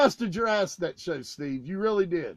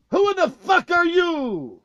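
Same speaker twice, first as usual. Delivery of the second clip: loud, high challenge; indignant shout; 8 semitones higher, 7 dB louder than usual. Retold or imitated. Tour manager confronting the rude singer, loud and high. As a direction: bark it back at the intruder. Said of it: "looks at him and goes".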